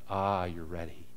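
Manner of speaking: low and hushed, a gentle knowing sigh; soft knowing reply. Retold or imitated. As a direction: say it softly, like a blessing.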